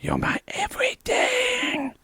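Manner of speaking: high unvoiced whisper, slow; breathy whisper. [name] whispering to Usman in the mock love scene. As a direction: whisper it like a melodrama.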